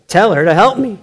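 high, loud, exasperated demand; loud whiny complaint to the Lord; exclaiming, as written. Acted. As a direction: read it with the exclamation point, pleading and fed up. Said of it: "I'm just gonna read it like it says".

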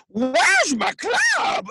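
high, drawn-out monster holler; Cookie Monster. Shown as a demonstration. Showing off the impression for co-hosts, as a hollering monster. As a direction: put on a big, slow creature voice. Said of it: "I do a really great Cookie Monster".

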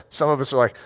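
ordinary delivery

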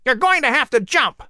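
high, urgent bird voice; screeching eagle. A narrator's reading. Eagle Cato calling down to Patrick, high and urgent. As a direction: call down like a circling eagle.

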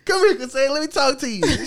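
high-pitched mock summons; imitated coworker. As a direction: call over a colleague in high put-on voice. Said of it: "she'll come in there".

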